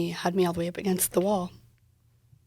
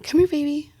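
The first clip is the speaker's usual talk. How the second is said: put-on gentle calling to child; 9 semitones higher, 6 dB louder than usual. Calling her son to her.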